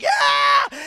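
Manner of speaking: very high, excited whoop; screaming cheer. Retold or imitated. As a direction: scream it like a parent cheering from the stands. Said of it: "I'm screaming like".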